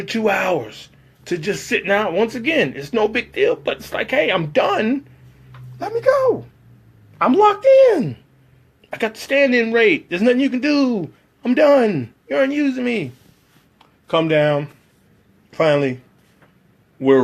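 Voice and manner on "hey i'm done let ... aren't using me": high-pitched frantic pleading; exasperated pleading. Retold or imitated. Begging the crew to let him go home, high and frantic. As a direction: whine to be let out.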